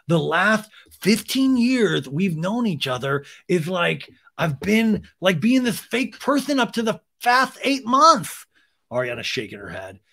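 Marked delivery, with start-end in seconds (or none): 0.1-8.5 high, put-on lisping protest; Sandoval. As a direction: plead your case in a mocking lispy voice. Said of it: "Sandoval's like".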